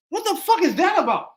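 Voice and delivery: ordinary delivery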